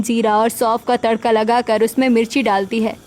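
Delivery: usual speaking voice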